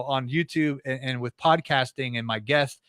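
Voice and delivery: ordinary delivery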